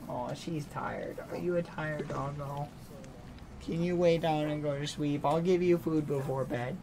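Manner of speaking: soft cooing to a sleepy dog; gentle, hushed reassurance; tender pet talk. Real, not acted. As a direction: soothe a dog towards sleep. Soothing a tired dog.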